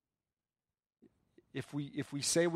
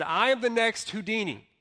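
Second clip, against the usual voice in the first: raised, higher-pitched showman's claim; bold boast; 6 semitones higher, 4 dB louder than usual. Retold or imitated. Magician announces himself to the public, bold boast. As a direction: proclaim it like a confident performer.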